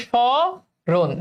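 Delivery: "স্মরণ" drawn out slowly as sho-ron, no m sound; pronunciation demo. Shown as a demonstration. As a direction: stretch the opening sho of "স্মরণ", then add ron. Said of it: "তাহলে এগুলোতে তালব্য স হয়ে যাবে".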